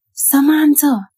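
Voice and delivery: high, loud call of the name; mother calling her child home. A narrator's reading. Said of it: "It was Mama's voice".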